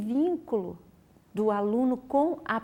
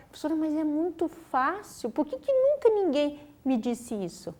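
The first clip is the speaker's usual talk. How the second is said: put-on a touch of an amazed child, higher; pupil's amazed delight; 6 semitones higher than usual. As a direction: mildly imitate the boy's wonder. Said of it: "ele olhava e falava".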